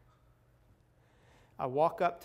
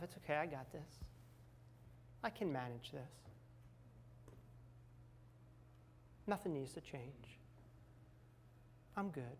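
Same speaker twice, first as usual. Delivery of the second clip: self-reassurance; 14 dB quieter than usual. Retold or imitated. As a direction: quietly reassure yourself, re-enacting it. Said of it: "I was telling myself".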